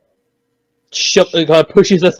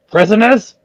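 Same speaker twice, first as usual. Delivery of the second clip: higher shouted question; shouting; 6 semitones higher than usual. Acted. Varric shouts at the collapsed guards. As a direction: call it out at them. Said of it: "kind of shouts at them".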